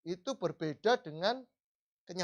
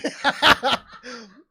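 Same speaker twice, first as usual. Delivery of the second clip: a real burst of laughter, high and loud; 6 semitones higher, 14 dB louder than usual. Real, not acted. Laughing at his own slip of the tongue during the sermon.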